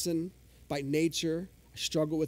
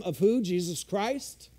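usual speaking voice

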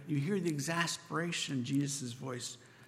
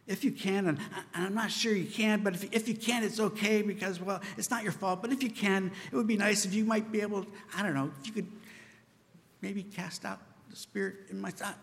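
higher, hesitant, timid pleading; wimpy prayer; 5 semitones higher than usual. Retold or imitated. Preacher mimics the father's timid prayer. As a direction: mumble a doubtful half-prayer. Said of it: "The Father prayed a wimpy prayer".

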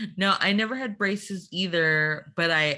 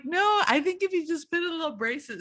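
much higher and fast, a nervous laughing protest; high fast pleading; 11 semitones higher, faster than usual. Retold or imitated. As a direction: rush it out, begging for the easy fix. Pleading with orthodontist.

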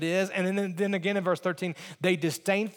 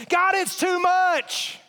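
complaining to God; 10 semitones higher, 4 dB louder, much slower than usual. Retold or imitated. Voicing the burden complaint.

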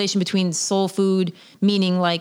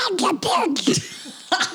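high squeaky laugh; 11 semitones higher than usual. Acted. Laughs before duck voice.

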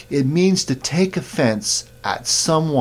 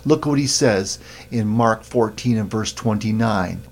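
usual speaking voice

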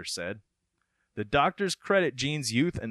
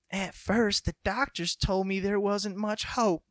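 high, put-on mother voice; mother impression; 8 semitones higher than usual. Mother voice, quoted reading the article aloud. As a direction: sound like a worried small-town mom. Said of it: "I hope that's a spot-on impression".

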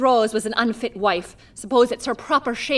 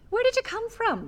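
startled; 11 semitones higher, 5 dB quieter than usual. Acted. Startled by sudden visitor.

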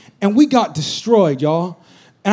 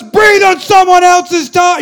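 loud, high, slow coach shout; 9 semitones higher, 7 dB louder, slower than usual. Retold or imitated. Coach shouting down a tired player. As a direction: shoot down the complaint. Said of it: "He goes".

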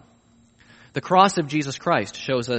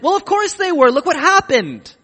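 much higher and louder, know-it-all tone; mock smug certainty; 13 semitones higher, 8 dB louder than usual. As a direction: play the crowd sure they deserved it. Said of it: "a lot of people would have said".

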